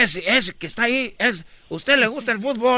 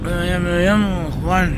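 slow drowsy man; 4 semitones lower, slower than usual. Acted. Passenger replies in other voice.

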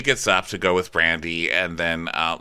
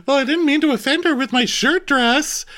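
much higher, put-on innocent voice; mock innocent; 4 dB louder than usual. Brandy feigning innocence about the shirt dress, high prissy.

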